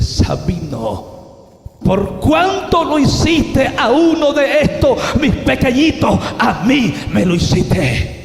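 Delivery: slow name, then loud high proclamation; voice from the radiance. Retold or imitated. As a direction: draw out the name, then thunder the verdict. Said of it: "aquella voz ... me dice".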